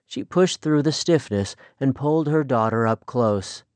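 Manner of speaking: ordinary delivery, nothing marked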